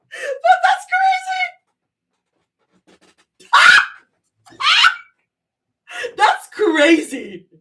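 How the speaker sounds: high, slow words stretched by laughter; laughing disbelief. Real, not acted. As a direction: gasp it out mid-laugh. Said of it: "I shouldn't be laughing".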